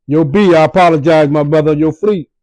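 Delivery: teasing sorry to rival fans; shouting a fake apology; hammed-up apology. Mock apology to Cowboy fans.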